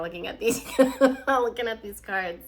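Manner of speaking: quick talk breaking into a laugh; amused, giggle escaping; real laughter. Real, not acted. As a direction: burst out laughing mid-sentence. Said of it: "kind of making me laugh".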